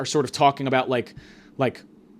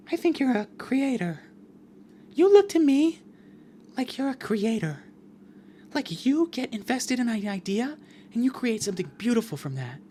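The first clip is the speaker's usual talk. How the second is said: high, soft put-on voice; higher-pitched, gentle mock encouragement; imitated earnest advisor; 8 semitones higher, 4 dB quieter than usual. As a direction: softly gush in a higher imitated voice. Imitating the woman advising her.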